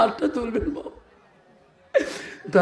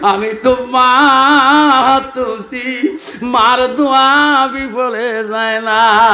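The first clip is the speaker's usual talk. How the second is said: chanted lament, slow and drawn out; weeping mother's plea; 4 semitones higher, 7 dB louder, much slower than usual. Acted. Mother pleading with God for her son, tearful chant. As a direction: plead in a melodic wail. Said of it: "মা কান্দে".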